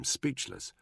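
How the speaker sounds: ordinary delivery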